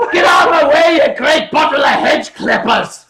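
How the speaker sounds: loud, high Glaswegian bellow; Glaswegian shout. Shown as a demonstration. Streamer demoing how a Glaswegian insults someone, shouted Glaswegian. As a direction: yell it like a harsh Glaswegian insult. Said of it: "it's so harsh and so loud".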